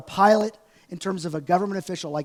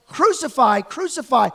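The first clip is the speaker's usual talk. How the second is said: louder, chanted crucify; mock crowd shout; 3 semitones higher, 6 dB louder than usual. Retold shout.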